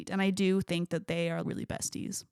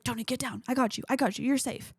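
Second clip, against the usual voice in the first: urgent bodyguard; 5 semitones higher than usual. Retold or imitated. Happy shielding Tony from the exploding plant, mock panicked and higher. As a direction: play it as a frantic bodyguard diving to cover.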